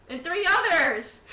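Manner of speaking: loud, high, drawn-out insistence; emphatic exclamation. Acted. Elf praising general's battlefield rescue, loud emphasis.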